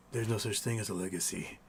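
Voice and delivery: low, soft mock gloom; hushed, deadpan doom; dark, nihilistic. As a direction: imitate a bleak answer, dropped low and quiet. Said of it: "goes to this dark, nihilistic place".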